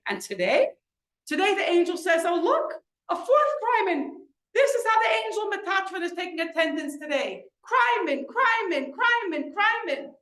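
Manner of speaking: high-pitched mock roll call, worked up. Grieving mother mimicking the angel taking attendance, upset and high. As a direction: re-enact a rising, agitated outburst. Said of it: "get a little bit more upset".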